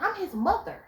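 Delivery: high, indignant reply; a mother insulted by her son's girl; lightly voiced indignant mom; offended mother.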